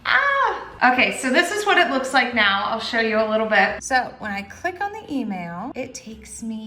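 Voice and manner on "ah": sudden much higher excited squeal; a short burst of real delight; excited shriek. Real, not acted. Vlogger seeing her ancestry results, excited squeal. Reaction to opening DNA results.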